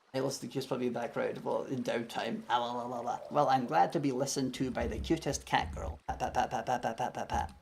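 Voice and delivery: soft, playful cooing voice. Real, not acted. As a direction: coo gently to a cute pet. Cooing at viewer's pet remark.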